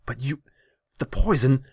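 high, loud, broken-off objection; agitated high outburst; shocked protest. A narrator's reading. Ruler sputters.

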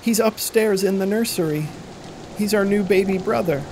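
put-on a girl explaining her parents vanished; frightened little girl.

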